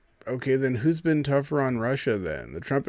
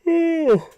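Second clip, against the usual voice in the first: big high yawn escaping mid-thought; a loud, high drawn-out yawn; real yawn; 10 dB louder than usual. Real, not acted. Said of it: "I'm yawning on you".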